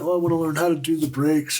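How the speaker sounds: high, eager girlish mimicry of his girlfriend; mimicking girlfriend. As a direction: chirp it like an excited girlfriend. Girlfriend quoted asking eagerly.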